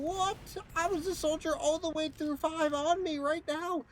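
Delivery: high-pitched mock outrage at teammates; mock screaming. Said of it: "I would be screaming at my team".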